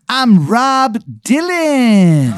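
high, very slow put-on voice; odd stranger introduction. Rob Dylan introduces himself to player, high and slow. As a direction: announce the name high and slow.

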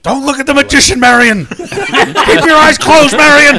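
high, very loud hammed-up warning; desperate mock-heroic scream; panicked movie-hero shout. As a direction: yell it like an adventure hero facing doom. Acted Indiana Jones warning Marion.

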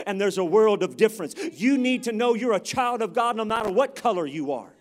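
loud, driving sermon delivery; full-force preaching. Real, not acted. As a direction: preach it out loud to the whole church.

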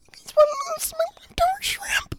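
put-on very high, slow little-old-lady squeak; Grammy McKenzie. Co-host quoting great-grandmother to herself for laughs, weird little old-lady imitation. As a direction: stretch it out in a tiny granny voice. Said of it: "It sounds just like her".

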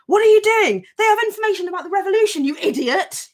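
hammed-up general blowing his top at the doorman; furious army general. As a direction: tear into the guy like a raging general. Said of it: "going apeshit".

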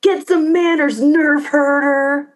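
high-pitched mock yell, drawn out at the end; imitated yell. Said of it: "And she yells".

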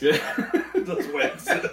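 high slow laughing line. Real, not acted. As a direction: laugh through the words. Teasing, laughing.

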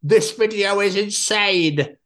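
slow drawn-out hype voice; mock the clickbait title, stretched out; mocking video caption. Host mocking trick shot videos, drawn-out hype voice.

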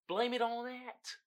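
ordinary talk

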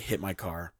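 low, soft retold threat; menacing dare. Daring driver pulling out, quietly menacing.

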